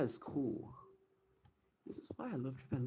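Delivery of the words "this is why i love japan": whisper. Real, not acted. Whispered awe at photo.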